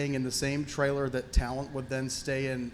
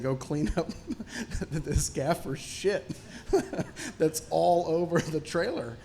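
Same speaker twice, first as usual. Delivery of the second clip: laughing through the quote; 3 semitones higher than usual. Retold or imitated. Retelling his boss's gross order, laughing through it. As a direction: quote breaks up in laughter, words slowed.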